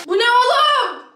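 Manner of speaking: high, loud exclamation of disbelief; outraged disbelief. Real, not acted. Vlogger seeing the tiny drink, loud high outrage. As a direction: cry out at the absurdity.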